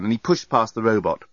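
ordinary delivery, nothing marked

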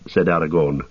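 ordinary delivery

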